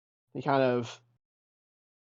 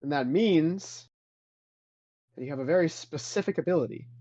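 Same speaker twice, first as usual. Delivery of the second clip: taunting Jedi spirit; 3 semitones higher than usual. Acted. Spectral master taunts Adrian to prove his gift, mocking challenge. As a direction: dare him to show his power.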